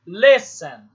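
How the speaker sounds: "Listen" with a loud first syllable, "ten" dropping away; stress on first syllable. Shown as a demonstration. Teacher showing the tonic syllable of listen, first-syllable stress. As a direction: punch the "Lis" in "Listen". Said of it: "O les é que é a sílaba tônica".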